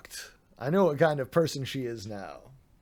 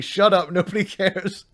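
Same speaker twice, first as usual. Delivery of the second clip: louder, higher mock chat voice; mock dismissive; 5 semitones higher, 6 dB louder than usual. Streamer imitating chat telling him to shut up, loud. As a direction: bark it like a bored crowd. Voicing chat's dismissive reaction.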